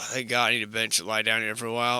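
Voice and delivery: ordinary delivery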